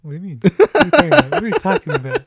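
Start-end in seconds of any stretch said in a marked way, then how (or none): 0.4-1.3 loud mock outrage, pitch jumped high; mock outraged yell. Shown as a demonstration. Podcast host fakes outrage at cohost, loud mock yell. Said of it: "repeated mock-offended question". 1.3-2.2 a lighter, rising incredulous repeat; milder mock disbelief. Shown as a demonstration. Host repeats the question to cohost, milder mock disbelief. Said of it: "repeated question, milder take".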